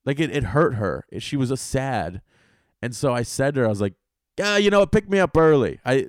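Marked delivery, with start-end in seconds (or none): 4.4-5.8 eager agreement. Retold or imitated. Retold eager reply to driver.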